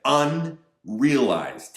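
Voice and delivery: "Unrealized" drawn out slow, high and loud; stress on unrealized. Shown as a demonstration. Commentator stressing "Unrealized" to his viewers, slow and loud. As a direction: hammer the word "Unrealized" slowly. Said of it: "Let me emphasize that".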